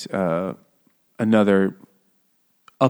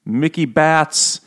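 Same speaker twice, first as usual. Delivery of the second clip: slow, higher, savouring each nickname; mock reverent; 5 semitones higher, 4 dB louder, much slower than usual. Friend lists silly mafia nicknames, slow and mock-admiring. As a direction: roll the names out slowly like legends. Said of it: "and you're like".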